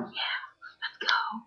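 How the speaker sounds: whispered, unvoiced mock cheer; a barely audible fake hype; half-hearted cheer. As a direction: breathe the cheer with no voice. Forced cheering at the show.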